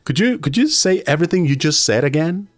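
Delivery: apologetic request. Acted. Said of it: "when you go like".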